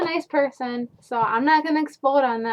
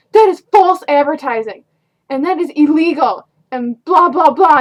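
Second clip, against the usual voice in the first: loud indignant mock tirade at the counter; hammed-up customer shouting at the cashier; imitating an angry rant; 3 semitones higher, 11 dB louder than usual.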